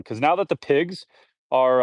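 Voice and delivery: ordinary talk, nothing marked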